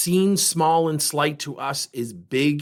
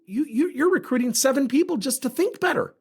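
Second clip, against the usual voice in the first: usual speaking voice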